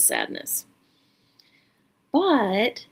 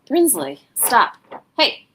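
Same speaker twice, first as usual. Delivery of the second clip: scolding; 4 semitones higher, 6 dB louder, slower than usual. Real, not acted. Scolding a pet mid-recording.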